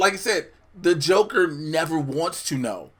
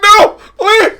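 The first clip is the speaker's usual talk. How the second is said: high, loud begging shout; hammed-up terrified plea; mock pleading scream; 12 dB louder than usual. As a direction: beg for mercy at full volume. Begging scene retold, shouting.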